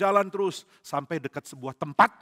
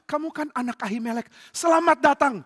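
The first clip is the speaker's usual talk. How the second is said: high, excited greeting growing loud; delighted welcome; 10 semitones higher, 7 dB louder than usual. Acted. Daud welcoming an old friend's son, delighted. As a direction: greet him with rising, high-pitched delight.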